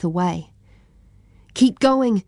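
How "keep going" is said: higher, louder urgent push. A narrator's reading. Boy urging his friend through the fire, urgent. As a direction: urge him on through the fire. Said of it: "said Yosefa".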